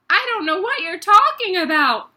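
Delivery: high baffled schoolboy; puzzled boy. Acted. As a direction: protest in confusion. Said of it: "more puzzled than ever".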